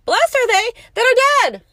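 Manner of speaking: loud, high ringing declaration; preacher's ringing cry; proclaiming. Acted. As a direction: declare it to the whole room.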